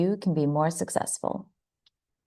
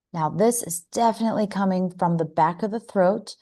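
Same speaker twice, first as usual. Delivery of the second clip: ordinary talk, nothing marked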